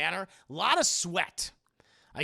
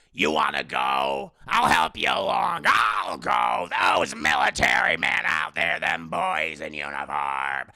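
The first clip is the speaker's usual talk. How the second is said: much lower and louder, slow roaring; booming father; 3 semitones lower, 8 dB louder, slower than usual. Retold or imitated. Father roars at Bruce about power, low slow bellow. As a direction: roar it out like a mad general.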